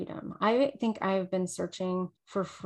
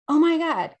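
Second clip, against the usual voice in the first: riled up; 8 semitones higher, 8 dB louder than usual. Retold or imitated. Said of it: "got me so riled up".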